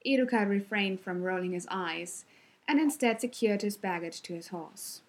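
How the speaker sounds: ordinary delivery, nothing marked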